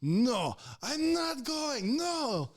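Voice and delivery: high, drawn-out panicked refusal; mock an old woman's frantic wail; imitated freak-out. Old patient refuses to leave with EMTs, imitated high panic. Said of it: "Freaking out so hard".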